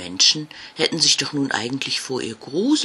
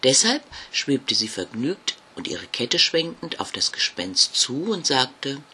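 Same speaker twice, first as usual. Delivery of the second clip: ordinary delivery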